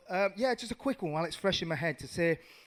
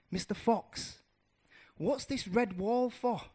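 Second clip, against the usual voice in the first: higher, drawn-out curious question; 6 semitones higher than usual. Acted. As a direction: ask as a curious little mouse. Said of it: "he gets curious".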